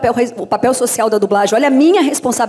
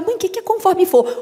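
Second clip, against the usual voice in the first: put-on higher, childlike questioning voice; child asking mother; 7 semitones higher than usual. Child asking mother what a phrase means, high kid voice. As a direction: ask like a puzzled little kid. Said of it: "a criança tem que perguntar".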